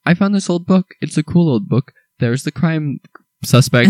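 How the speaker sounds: high-pitched, carefree enthusiasm; mock excitement, pitched well up; Sherlock Holmes. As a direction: imitate an easily distracted, delighted enthusiast. Imitates Sherlock's glee amid a case.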